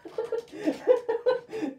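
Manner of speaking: loud, high laughing fit; high burst of laughter. Real, not acted. Laughing at own story.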